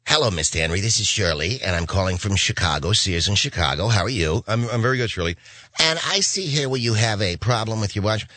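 lightly voiced sweet Sears caller; sweet lady caller. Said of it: "she sounded like the greatest gal".

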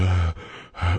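imitated wordless phone breather; heavy breathing imitation. Radio host mimics Cliff answering with breath alone, heavy breathing. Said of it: "he started breathing like Erica Dorton".

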